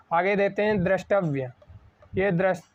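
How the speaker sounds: usual speaking voice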